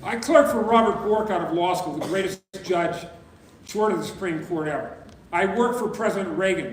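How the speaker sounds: rally the room with conviction; full-voiced formal address; rousing speech.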